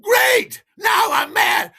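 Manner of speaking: very high, loud furious shriek; acted drunken rage at full pitch; screaming. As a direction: scream it in hysterical outrage. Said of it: "I'm just screaming at him".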